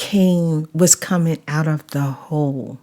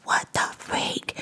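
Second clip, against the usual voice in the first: whisper; 11 dB quieter than usual. Retold or imitated. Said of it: "I was like".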